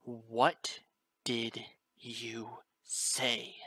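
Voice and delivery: very slow, drawn-out challenge; menacing challenge. A narrator's reading. Story character challenging someone, slow and menacing. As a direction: stretch out each word of the question slowly.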